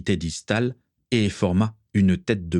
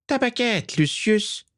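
high, hissing villain voice, expressionless command; high-pitched impassive villain demand; Voldemort; slower than usual. A narrator's reading. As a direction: give the order in a very high sinister voice. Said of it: "la voix aiguë et sifflante de Voldemort".

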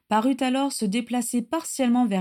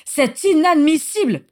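a much higher, louder outraged cry; outraged outburst; 9 semitones higher, 9 dB louder than usual. A narrator's reading. Regulus outraged at Lucius, a loud high outburst. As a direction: explode in outrage.

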